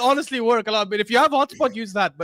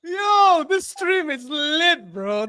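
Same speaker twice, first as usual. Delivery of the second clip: excited shout; 6 semitones higher, much slower than usual. Real, not acted.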